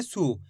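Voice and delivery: सु with a short, falling u; short u sound. Shown as a demonstration. Teacher shows learners the short matra, short u in सु. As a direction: say सु briefly, not stretched. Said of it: "सा का उच्चारण कैसे करेंगे".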